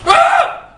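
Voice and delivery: high shriek of fright; terrified scream. Real, not acted. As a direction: scream in sudden terror. Horror game scream.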